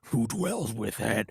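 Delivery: slow, dark demonic shout. Retold or imitated. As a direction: intone it like a possessed demon. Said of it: "talking like the demons in".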